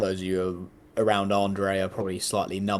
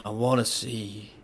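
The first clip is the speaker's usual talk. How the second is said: weak, slow dying voice; dying man. Acted. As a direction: fade out like a dying soldier. Dying character's last words.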